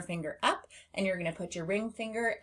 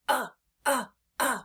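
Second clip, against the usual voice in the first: belly-push grunt; 6 semitones higher than usual. Shown as a demonstration. Teacher shows pushing the belly for "uh". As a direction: grunt "uh" as the tummy goes in. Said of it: "push in slightly while you say this sound".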